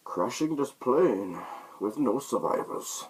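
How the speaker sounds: villain announcing the plane crash, slow and menacing; Bane impression. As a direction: deliver it like a masked villain's threat. Quoting Bane in the film.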